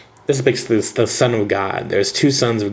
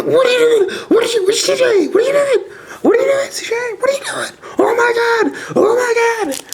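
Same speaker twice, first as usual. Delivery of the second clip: frantic shrieking, much higher and louder; panicked; 3 dB louder than usual. Retold or imitated. Friend rips his prized card, frantic high-pitched shrieking at CJ. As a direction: shriek in disbelief as your best card gets torn. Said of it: "He was losing his mind".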